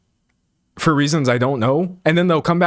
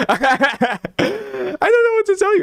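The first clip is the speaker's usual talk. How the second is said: high, slow, laughing squeal; helpless laughter pushing the voice way up; slower than usual. Real, not acted. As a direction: crack up while drawling it out. Cracks up at own accent.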